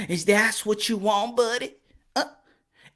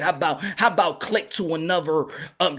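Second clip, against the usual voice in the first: ordinary delivery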